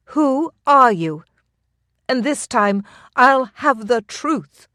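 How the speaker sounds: high, loud, slow demanding challenge; bow drawn, she demands his name; fierce demand. A narrator's reading. Aaliyah aims bow, demands truth.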